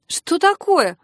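startled sister, pitch jumping up; taken aback. A narrator's reading. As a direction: ask it as if caught off guard. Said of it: "опешила сестрица".

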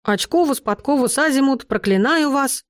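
put-on boy showing off a nonsense spell; demonstrating a curse. Shown as a demonstration. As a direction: chant out a made-up curse. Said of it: "Вот смотри".

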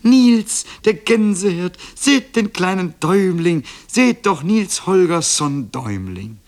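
high, loud excited sparrow cry; calling out. A narrator's reading. Sparrow alerting the yard to the tiny boy, calling out. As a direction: shout it out for the whole yard to hear. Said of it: "da rief er auch schon".

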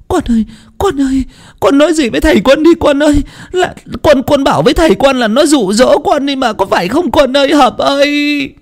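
very high, wailing mother's voice; tearful pleading. Acted. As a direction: beg your child in a high, desperate wail. Weeping mother begs her son.

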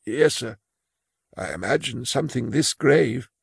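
put-on high, timid old clerk voice; a frail old clerk confessing his fear; old man Imus. Imus asks about superior.

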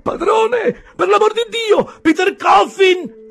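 high, loud scream for help; terrified yell. Acted. Narrator calling the landlord for help, panicked high scream.

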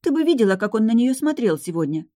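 high-pitched, insistent outburst; indignant. A narrator's reading. Granddaughter telling grandmother what she saw, indignant. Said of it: "возмутилась Линара".

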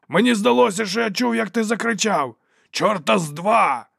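high, loud arguing voice; heated retort. A narrator's reading. Fighters quarrel over who shouted the signal, yelling. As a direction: argue back at a shout.

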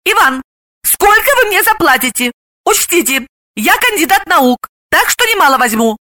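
high-pitched, businesslike Galina-type chatterbox; name your fee in a high voice; brash haggling woman. Acted.

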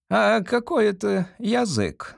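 high, slow, puzzled question; curious. A narrator's reading. Examinee asking judges about the riddle, curious. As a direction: ask in a lifted, wondering voice. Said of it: "с любопытством спросил Томас".

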